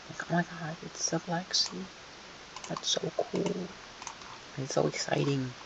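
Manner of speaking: high, soft put-on voice of wonder; mock gushing. Green team's dream come true, mock gushing in a high soft voice. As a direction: gush quietly in a high pretend voice.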